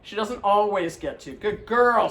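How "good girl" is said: excited praise. Real, not acted. Praising the dog.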